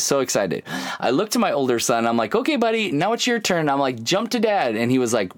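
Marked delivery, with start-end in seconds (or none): 2.3-4.7 higher, upbeat coaxing; bright encouraging dad, pitched up. Retold or imitated. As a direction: cheerfully urge the kid to jump. Dad coaxing older son.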